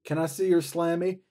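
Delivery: lightly voiced, patronizing baby talk; talking down to a kid. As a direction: imitate someone talking down to a little girl. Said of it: "talks to her like a little kid".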